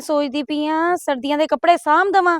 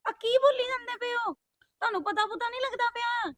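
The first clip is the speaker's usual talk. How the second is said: high, hushed objection; dismayed, pitched up and softer; shocked protest; 7 semitones higher, 13 dB quieter than usual. Acted. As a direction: protest to mother in a soft, high voice. Daughter protests at mother's insult.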